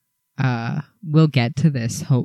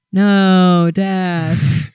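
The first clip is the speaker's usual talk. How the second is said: mock dread; 3 semitones higher, 4 dB louder than usual. Children in recap facing killers.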